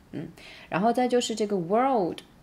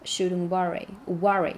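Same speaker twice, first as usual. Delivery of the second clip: normal talking voice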